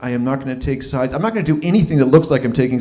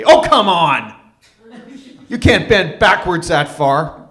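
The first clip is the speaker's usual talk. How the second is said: loud, higher-pitched mock protest; exasperated outburst; 4 semitones higher, 6 dB louder, slower than usual. Man wants to answer his ex's tirade, exasperated shout. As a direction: burst out in comic disbelief, stretching the words.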